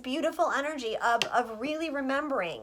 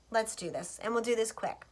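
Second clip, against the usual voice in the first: ordinary delivery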